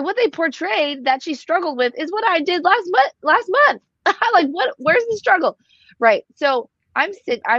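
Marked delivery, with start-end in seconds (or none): 0.0-5.6 much higher, laughing incredulity; mocking disbelief. About Mary's relapse in the show, high mocking disbelief. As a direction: scoff that it's no struggle at all. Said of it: "I'm like".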